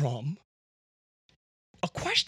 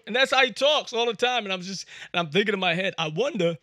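ordinary talk

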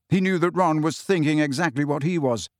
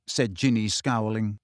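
ordinary delivery

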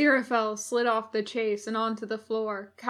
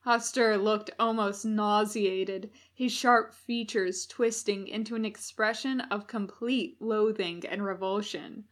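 ordinary delivery

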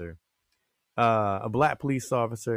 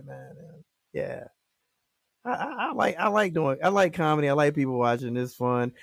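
ordinary delivery

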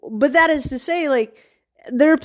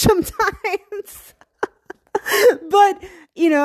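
laughing it off; 8 semitones higher, slower than usual. Real, not acted. Laughs at own swearing.

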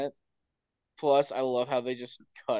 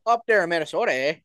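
put-on Minnesotan accent; 3 semitones higher, 4 dB louder, much faster than usual. Friend demonstrates a Northern accent, mock Minnesotan. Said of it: "Like, Minnesota, Canadian. I love that".

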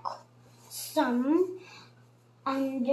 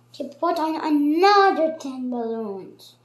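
usual speaking voice

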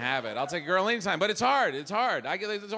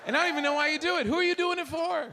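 building rant; 9 semitones higher, faster than usual. Real, not acted. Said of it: "rant at women".

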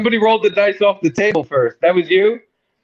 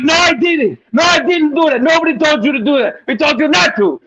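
retold yell; 10 semitones higher than usual. Dealer denying blame to the gambler, mock yell. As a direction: shout the denial back at him. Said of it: "And she was like".

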